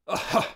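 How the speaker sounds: short, loud, hearty burst of laughter; sudden cheerful laugh. Acted. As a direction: laugh out openly and amiably. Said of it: "Ainz burst into a cheerful laugh".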